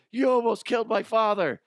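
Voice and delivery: put-on high, loud kid's shout; imitating his daughter. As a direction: cry it out like an accusing child. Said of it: "my daughter goes".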